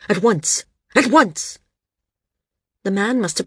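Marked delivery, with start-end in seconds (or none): 0.1-0.6 firm, contained command; snapped order. A narrator's reading. Landsman orders his guard to hurry, snapped but contained. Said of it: "he snapped". 0.9-1.6 higher, louder, impatient repeat; snapped order, escalating. A narrator's reading. Landsman repeats his order to the guard, snapping harder. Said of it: "he snapped".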